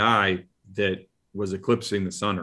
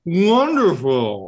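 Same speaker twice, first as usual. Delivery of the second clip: higher, louder, slow drawn-out delight; higher delighted creature; 9 semitones higher, 6 dB louder, slower than usual. Acted. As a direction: savour the word with pleasure. GM voices Ralakor.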